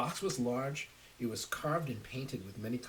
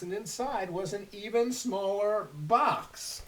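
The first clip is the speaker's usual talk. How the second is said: building suspense; 9 semitones higher, 10 dB louder, slower than usual. A narrator's reading. Suspense over nested boxes.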